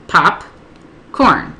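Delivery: POP, pause, CORN as two separate beats; broken up by syllable. Shown as a demonstration. Tutor modelling breaking up a word, POP and CORN apart. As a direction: say "popcorn" split cleanly into POP ... CORN. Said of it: "Break it up by syllable".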